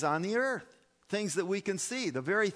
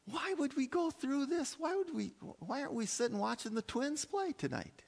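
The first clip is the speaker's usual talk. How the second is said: high-pitched, incredulous questioning; exasperated complaint; 10 semitones higher, 3 dB quieter than usual. Acted. Preacher voicing churchgoers who'd rather watch the Twins, exasperated. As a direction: protest in a much higher voice. Acted complaint if faith were pointless.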